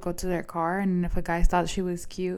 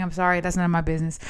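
normal talking voice

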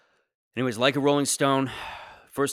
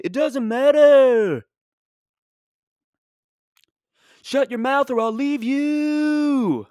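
high, louder folk-singer rant; 13 semitones higher, 6 dB louder, slower than usual. Retold or imitated. Comic voicing his angry father as a [name] song. Dad snaps back, [name] voice.

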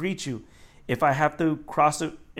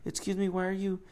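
softer, slightly raised polite query; discreet complaint; 3 semitones higher, 6 dB quieter than usual. Acted. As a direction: act out a complaint kept between two people. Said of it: "she gotta say it loud enough".

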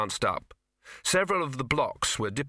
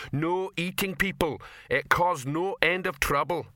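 slow, raised, heavily spaced troll warning; menacing troll; 6 semitones higher than usual. A narrator's reading. Rock warning a hungry troll off people, menacing. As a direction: lay down the law slowly, troll-style. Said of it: "said Rock menacingly".

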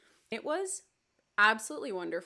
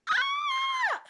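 very high, loud fangirl squeal; excited squeal; 7 dB louder than usual. Retold or imitated. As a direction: shriek with delight. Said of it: "'cause I was like".

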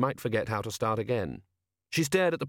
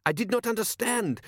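much higher, nervous answer; baffled frightened sailor; 11 semitones higher than usual. Acted. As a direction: plead confusion. Lookout baffled.

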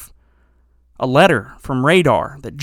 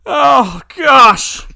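higher, louder mock-shocked oh gosh; shocked exclamation; 10 semitones higher, 7 dB louder than usual. Real, not acted. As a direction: blurt it out in disbelief. Shocked reaction, shout.

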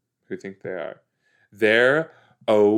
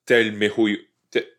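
put-on Italian accent; 5 dB louder than usual. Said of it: "I was trying to do an Italian accent".

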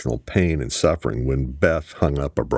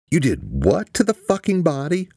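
high-pitched shriek of disbelief, volume held back; outraged scream; 13 semitones higher than usual. A narrator's reading. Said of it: "Serena heard Beth scream".

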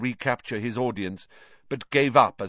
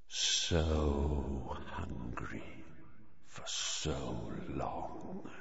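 very slow, much lower and softer; cold monster voice; 9 semitones lower, 11 dB quieter, much slower than usual. Acted. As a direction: drag out the hunger menacingly. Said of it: "the same cold, murderous voice".

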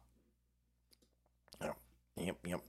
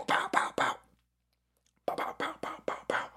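whispered punch noises; 7 dB quieter than usual. Pretend. Punching sounds after "give 'em elbow.

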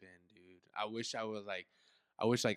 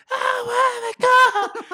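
very high, loud drawn-out shriek; screaming girl cousin; 13 dB louder, much slower than usual. Retold or imitated. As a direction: imitate a terrified girl's scream. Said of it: "my girl cousin was like".

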